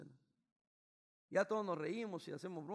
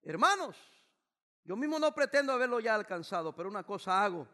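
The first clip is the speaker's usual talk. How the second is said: raised pulpit voice; fervent preaching; 3 semitones higher, 11 dB louder than usual. Real, not acted. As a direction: proclaim it to the brethren. Preaching at full force.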